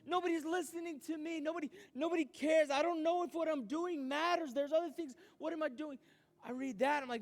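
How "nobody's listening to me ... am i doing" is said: relieved outburst. Retold or imitated. Reading a note, voicing his doubts.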